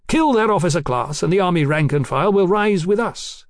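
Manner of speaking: plain reading voice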